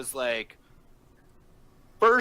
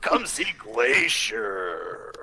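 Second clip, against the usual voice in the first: slow, drawn-out announcer voice; monster truck ad; 4 semitones lower, much slower than usual. Pretend. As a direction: stretch each word like a promo. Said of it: "like a monster truck commercial".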